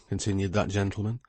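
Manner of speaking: ordinary talk, nothing marked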